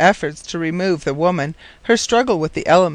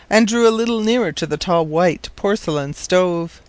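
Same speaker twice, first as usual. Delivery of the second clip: ordinary delivery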